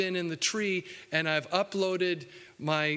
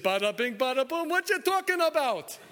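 high, brash mock New York accent; Brooklyn tough guy; 7 semitones higher than usual. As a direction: play Peter as a Brooklyn wise guy. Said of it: "And Peter said".

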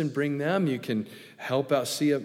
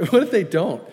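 louder, higher, breaking into a laugh; 7 semitones higher, 9 dB louder than usual. Real, not acted. Preacher joking that the helpers may not show, laughing. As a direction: say it through real laughter. Laughs at his own worry.

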